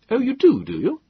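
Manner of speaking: high, loud mocking challenge; sarcastic retort. A narrator's reading. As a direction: throw his words back at him, pitched high. Young self sarcastic retort.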